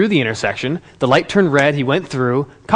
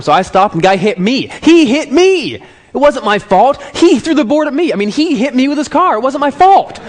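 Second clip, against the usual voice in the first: higher, louder gloating teen imitation; proud self-vindication; 6 semitones higher, 4 dB louder than usual. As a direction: build to a triumphant, high-pitched insistence. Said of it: "He's all proud".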